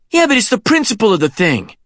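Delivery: high-pitched defensive comeback; insistent protest. Acted. Kenny defends himself to Sean, insistent protest. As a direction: argue back, pitched well up. Boy argues back in quarrel.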